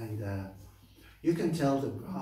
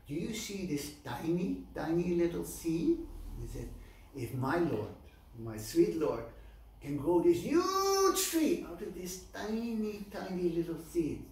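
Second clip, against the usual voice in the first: high, emphatic marvelling, stretching the size words; wonderstruck cobbler; 6 semitones higher than usual. Acted. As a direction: explain a miracle with childlike awe. Cobbler explains faith with wonder.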